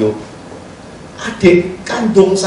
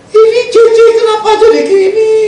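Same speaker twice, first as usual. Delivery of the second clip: panicked shout; 4 dB louder than usual. Retold or imitated. Panicked family call.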